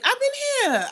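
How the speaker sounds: very high, loud exclamation; indignant squeal of a reply; exclaimed protest. Real, not acted. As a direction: protest loudly, pitch shooting right up. Protesting a viewer's where-have-you-been.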